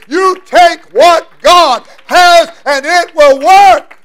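loud, high, slow preaching; preaching at full force. Acted. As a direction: drive the word home at full force.

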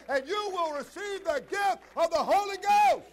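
high, loud, building preacher's roar; preaching at full force. Acted. Preacher to his congregation, full-force preaching. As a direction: drive each line harder to the climax.

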